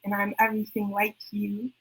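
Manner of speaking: quiet spoken-word refrain. Acted. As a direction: let the line sink softly. Poem refrain, quiet take.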